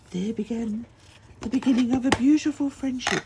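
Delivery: playing it up like a movie line; grand announcement. Real, not acted. Telling viewers how she met her friend, excited and loud.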